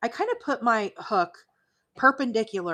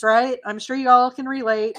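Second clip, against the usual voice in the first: loud laughing; 5 semitones higher, 10 dB louder than usual. Real, not acted. Laughing off shedding hair.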